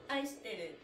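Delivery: normal talking voice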